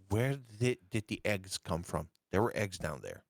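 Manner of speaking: ordinary delivery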